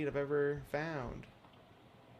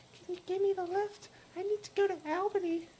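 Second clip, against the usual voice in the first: put-on much higher, thin ghostly girl voice; hitchhiker Lydia. Hitchhiker asking the player for a ride, high ghostly voice. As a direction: play the strange girl in the rain begging a lift.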